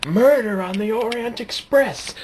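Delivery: hammed-up high exclamation; mock dramatic cry. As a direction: announce the mystery like a movie trailer. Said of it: "screaming that very loudly".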